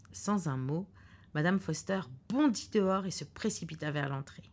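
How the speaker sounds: usual speaking voice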